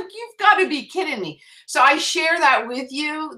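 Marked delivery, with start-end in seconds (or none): 0.0-1.4 high-pitched incredulous protest; squeaky-high overwhelmed reaction; retold disbelief. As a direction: pitch it way up in amazement. Retold disbelief at being honoured.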